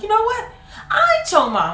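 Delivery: high laughing retort; high laughing comeback; mock grandmother retort. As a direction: play grandma firing back. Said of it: "she was like".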